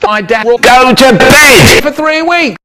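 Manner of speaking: loud high shout; calling out. Acted. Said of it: "My brother called out".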